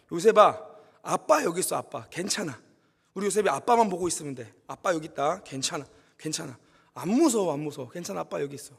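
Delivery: put-on calm a frightened child gently; soothing father. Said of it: "그윽한 목소리가 들리는 거예요". (겁먹은 아들을 달래는 낮고 부드러운 아버지 목소리)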